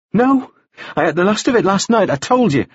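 insist, starting high and coming down; insistent woman character. A narrator's reading. Woman telling the doubting man she told him already, insistent.

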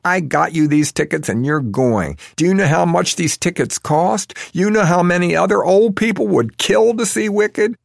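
high, loud scolding building up; angry rant. A narrator's reading. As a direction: scold the parents, rising fury. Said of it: "suddenly angry".

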